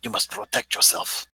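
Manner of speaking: slow hushed whisper. Acted. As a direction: whisper it like a secret warning. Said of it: "Why are you guys whispering?".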